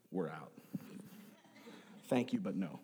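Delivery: low, hushed bow-out; quiet polite refusal. Retold or imitated. Congregation slipping away from tongues preaching, low and quiet. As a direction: drop right down and decline under your breath.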